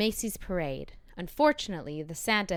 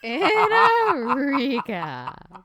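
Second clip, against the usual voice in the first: slow drawn-out echo; 4 semitones higher, 9 dB louder, much slower than usual. Acted. Echoes slow, loud, drawn out.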